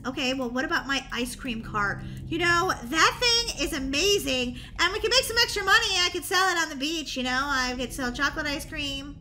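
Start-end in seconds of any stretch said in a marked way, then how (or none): none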